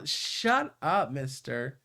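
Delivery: ordinary delivery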